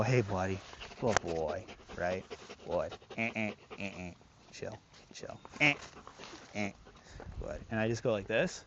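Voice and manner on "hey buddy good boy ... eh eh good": soft low calm praise for the dog. Shown as a demonstration. Trainer calming the dog to greet him, soft and low. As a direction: settle him quietly, no excitement. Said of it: "and I go".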